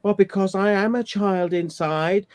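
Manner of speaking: mocking whiny excuse. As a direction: parody his excuse in a simpering voice. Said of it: "He's gonna say".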